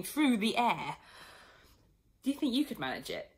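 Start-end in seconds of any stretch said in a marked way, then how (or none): none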